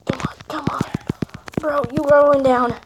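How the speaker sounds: usual speaking voice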